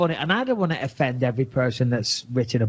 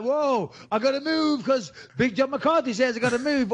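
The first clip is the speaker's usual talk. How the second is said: laughing fighter; 9 semitones higher than usual. Retold or imitated. Fighter reacting to the referee.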